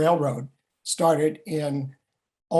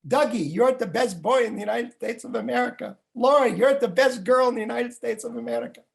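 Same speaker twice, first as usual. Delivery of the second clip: put-on higher Russian-accented grandfather praise; 6 semitones higher than usual. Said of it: "say to me in his Russian accent".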